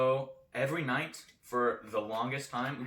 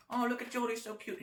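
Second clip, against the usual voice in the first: high-pitched mock cooing; cooing adult; 9 semitones higher than usual. Grown-up admiring a five-year-old, high cooing voice. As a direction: gush over a little kid, pitched up.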